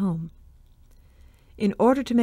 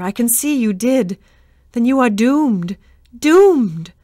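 higher and louder, building to a cry of DOOMED; terrified outcry; 4 semitones higher, 8 dB louder, slower than usual. A narrator's reading. Berenice warning Jason he is doomed, panicked cry. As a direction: wail the doom rising in panic. Berenice in terror declaring doom.

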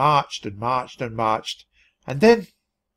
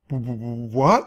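stammered; slower than usual. A narrator's reading. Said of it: "I stammered".

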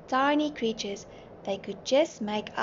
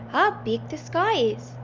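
high-pitched exclamation of wonder; wide-eyed, raised-voice wonder; amazed cry; 6 semitones higher, 3 dB louder than usual. A narrator's reading. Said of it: "the passengers cried".

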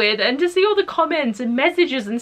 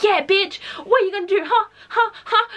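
imitating Henry yelling at his brother, higher; retold scream; 9 semitones higher than usual. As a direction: goad him like a cocky brother. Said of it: "he was just screaming shit at him".

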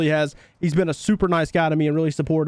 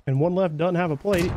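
ordinary delivery

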